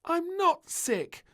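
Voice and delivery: very high, slow insistent protest; arguing. A narrator's reading. Princess insists to the maid she is well, arguing. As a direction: dig in and argue back. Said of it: "argued the little princess".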